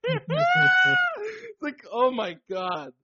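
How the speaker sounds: very high shriek of laughter; laughing shriek. Real, not acted. Cracking up at his Thanksgiving insult, shrieking. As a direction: squeal through the laugh. Laughing outburst at own message.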